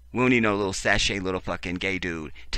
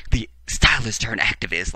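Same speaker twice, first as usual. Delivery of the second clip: louder, scornful repeat of "The stylist turned activist"; scoffing, loud incredulous echo; mocking repeat; 3 semitones higher, 7 dB louder than usual. Shown as a demonstration. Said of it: "That doesn't even sound right".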